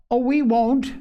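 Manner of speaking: put-on high-pitched children's remorseful outcry; remorseful cry. A narrator's reading. Both children promising Polly to behave, crying out in remorse. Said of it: "perfectly overwhelmed with remorse".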